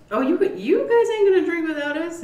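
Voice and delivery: high-pitched quoted protest; mock indignant relatives. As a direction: play relatives jokingly refusing to be left out. Retold relatives butting in at bar.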